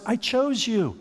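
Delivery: high tender repeated assurance; reassuring divine voice. Acted. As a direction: reassure them, leaning on chose. God reassuring His people.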